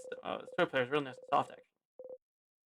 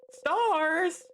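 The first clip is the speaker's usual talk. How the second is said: frustrated cry; 13 semitones higher than usual. Acted. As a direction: fling the word out in frustration. Frustrated outburst at no tears.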